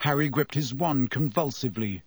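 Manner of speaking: ordinary delivery, nothing marked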